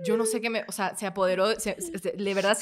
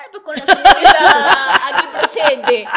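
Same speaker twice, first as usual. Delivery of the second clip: slow, loud, higher formal policeman; slow officious cop; 5 semitones higher, 12 dB louder, much slower than usual. Retold or imitated. Policeman speaking to Alex on the phone, slow officious tone. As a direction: drawl it like a bored officer. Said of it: "y el señor".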